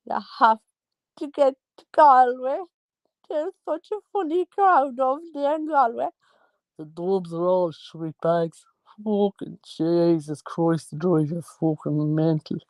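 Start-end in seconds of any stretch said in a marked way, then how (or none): 1.2-6.1 high-pitched Galway accent. Shown as a demonstration. Storyteller showing off all the accents, Galway accent. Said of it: "And then there's Galway". 6.8-12.6 slow, drawn-out Dublin accent. Shown as a demonstration. Storyteller showing off all the accents, slow Dublin accent. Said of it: "in all the accents".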